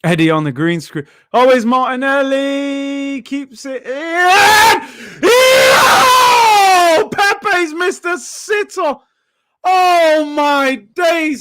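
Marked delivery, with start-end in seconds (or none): 1.3-4.8 slow, rising commentary that swells into a loud peak; rising excitement. Real, not acted. Live reaction to a chance. 5.2-8.9 high, loud cry of disbelief; much higher, loud exclamation, then slow lament; real shout. Real, not acted. Reacting to a missed chance. 9.6-11.4 high, loud exclamation; loud, high shocked cry; real shout. Real, not acted. Reacting to a goal-line clearance.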